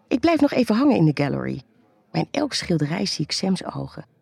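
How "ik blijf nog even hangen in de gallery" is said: usual speaking voice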